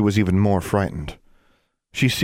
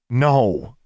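vehement no; 6 semitones higher, 4 dB louder than usual. A narrator's reading. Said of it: "shook her head vehemently".

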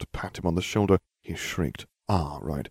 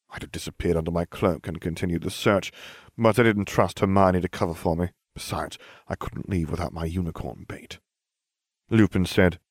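ordinary delivery, nothing marked

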